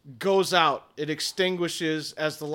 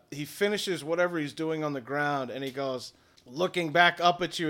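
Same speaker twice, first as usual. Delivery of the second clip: usual speaking voice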